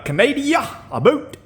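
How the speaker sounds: joking Canadian, stretch out a boot; mock Canadian accent. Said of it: "I can't sound Canadian".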